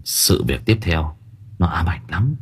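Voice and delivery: whispered ominous warning; eerie whisper. A narrator's reading. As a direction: drop to a whisper, spooky. Storyteller hushes for horror.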